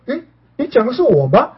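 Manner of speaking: high, laughing disbelief; surprised. Retold or imitated. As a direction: caught off guard by praise. Overhears friends praising his voice. (惊讶地笑着问)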